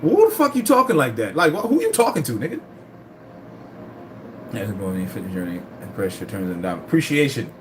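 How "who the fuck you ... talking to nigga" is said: mock outrage. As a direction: fire back as if insulted, pitched up. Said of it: "I'm like".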